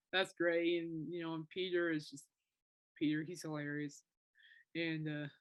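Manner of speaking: ordinary delivery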